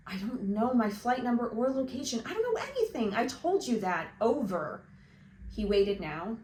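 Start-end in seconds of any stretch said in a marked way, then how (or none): 0.1-4.8 frustrated outburst. Acted. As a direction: pitch cracks high on "don't". Frustrated boy on radio.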